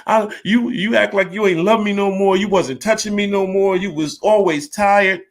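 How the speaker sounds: imitating his wife begging not to divorce; pleading wife. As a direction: beg like she's trying to save it. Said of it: "And then she was like".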